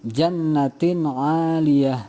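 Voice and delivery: "jannatin aliyah" drawn out slowly with a clean n; clear izhar recitation. Shown as a demonstration. Teacher modelling tanwin before ain, slow and clear. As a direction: recite slowly, the n not nasalised. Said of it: "Bacanya adalah".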